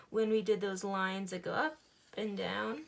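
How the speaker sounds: ordinary talk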